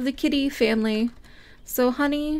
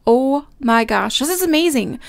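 ordinary talk

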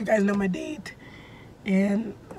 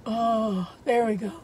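ordinary delivery

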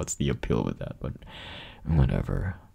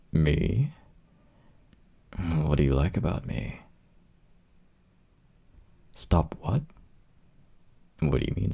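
normal talking voice

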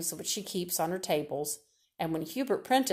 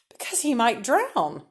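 slow squeal pushed higher and louder; 5 semitones higher, 5 dB louder than usual. A narrator's reading. Neighbour alarmed about her son drowning, squealing. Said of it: "squealed Missus Broomrack".

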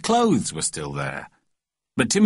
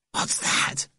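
put-on frightened boy's voiceless whisper; scared, breath-only question; terrified whisper; 4 dB quieter than usual. A narrator's reading. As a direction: whisper it in fear of a noise outside. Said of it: "came Timothy's terrified whisper".

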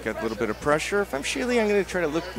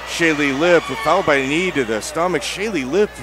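louder, raised play-by-play; excited call; 4 semitones higher, 5 dB louder than usual. Real, not acted. Big punch lands.

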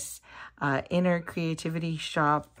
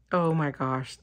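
ordinary talk, nothing marked

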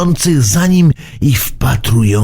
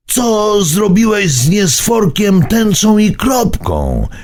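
frightened cry; 9 semitones higher than usual. A narrator's reading. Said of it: "krzyknął Burbi, przestraszony nie na żarty".

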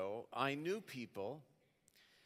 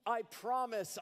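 mock scream; 10 semitones higher than usual. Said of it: "I scream".

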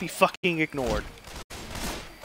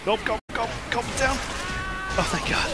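panicking gamer; 8 semitones higher than usual. Real, not acted.

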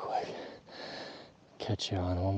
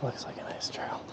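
whispering; 7 dB quieter than usual. Real, not acted. Stalking elk, keeping quiet.

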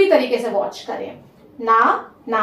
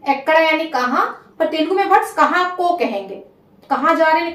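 ordinary talk, nothing marked

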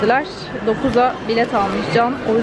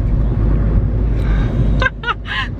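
high laugh; 13 semitones higher than usual. Real, not acted. Teased about the gum.

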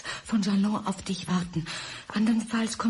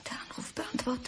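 whisper; 4 semitones higher, 10 dB quieter than usual. Acted. Whispered aside.

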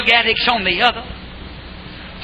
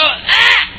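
mock pained cry; 9 semitones higher than usual. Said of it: "and you go".

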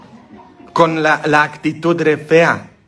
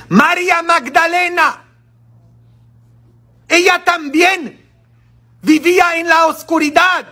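fiery preaching; 12 semitones higher than usual. Acted. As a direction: preach it with full force. Preaching at full force.

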